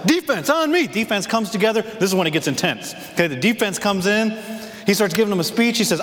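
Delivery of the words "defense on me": coach's call. Retold or imitated. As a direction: bark the team over. Coach calls defense in.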